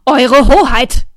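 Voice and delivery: loud, slow royal bellow; shout. A narrator's reading. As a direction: roar it at the witch. (laut brüllender König)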